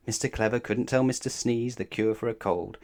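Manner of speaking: plain reading voice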